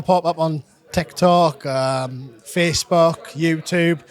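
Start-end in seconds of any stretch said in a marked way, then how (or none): none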